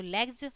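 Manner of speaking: "लेग्स" pronounced "लेग्ज"; final j sound. Shown as a demonstration. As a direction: turn the last s of "लेग्स" into j. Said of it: "ज हो गया". ("लेग्स" का अंतिम स, ज जैसा)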